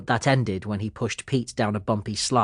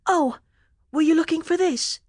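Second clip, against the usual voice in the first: put-on very high boy's voice, offering; helpful boy asking; 3 dB louder than usual. A narrator's reading. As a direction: hold it out helpfully. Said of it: "he asked".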